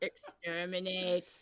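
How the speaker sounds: slow, low mechanical Dalek; Dalek impression. Joking about sending a Dalek after someone, Dalek voice. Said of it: "send a Dalek after her. Be like".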